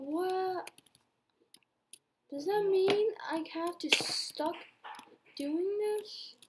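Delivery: high, dismayed protest; shocked whine. Real, not acted. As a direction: squeal in disbelief. Dismay at being stuck.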